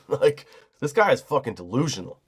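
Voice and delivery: ordinary delivery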